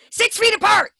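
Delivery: a sudden high, loud yell; mock shout. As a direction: shout it out as a joke warning. Mock scolding co-host.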